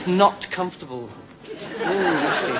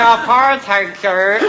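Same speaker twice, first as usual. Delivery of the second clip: higher, louder mock-genteel caller; caller's reply; 6 semitones higher, 8 dB louder, much slower than usual. Phone-in caller answering the DJ, mock-genteel and higher. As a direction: answer like a prim old caller. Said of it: "And they say".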